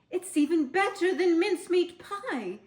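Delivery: high, loud, delighted voice; happy Missus Fox. A narrator's reading. Missus Fox gushes about the treetop to her husband. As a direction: gush over the present. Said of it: "with a happy sigh".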